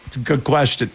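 talking through real laughter; laughing. Real, not acted. Host answering co-host's silly question about the story, laughing. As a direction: get it out while still chuckling.

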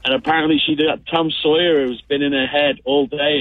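normal talking voice

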